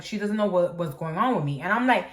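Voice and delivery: ordinary delivery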